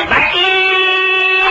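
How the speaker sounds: very high, drawn-out hawker cry; milkman's call. Retold or imitated. Milkman calls out at dawn with a long high cry. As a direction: stretch the call like a street milkman. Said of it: "બૂમ પાડે".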